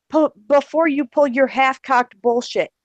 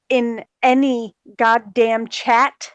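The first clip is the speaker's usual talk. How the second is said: normal talking voice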